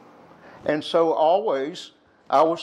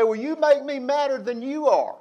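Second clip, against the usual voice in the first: high, mock-angry kid's comeback; higher, bratty one-upping retort; childish escalation; 11 semitones higher than usual. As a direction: top her like a squabbling child.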